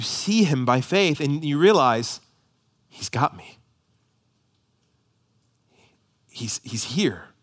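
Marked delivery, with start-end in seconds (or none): 2.9-7.3 halting, near-whispered awe; soft, slow and breath-led; hushed realization. Acted. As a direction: let it dawn on you in a hush. Voicing a convert's quiet realization.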